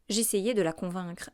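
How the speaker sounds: ordinary delivery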